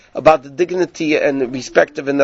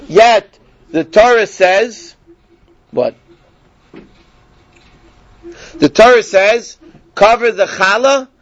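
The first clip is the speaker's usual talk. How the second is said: emphatic teaching; 3 semitones higher, 3 dB louder, slower than usual. Acted. Building to the Torah's answer.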